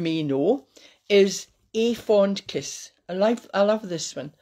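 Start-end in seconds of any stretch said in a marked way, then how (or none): none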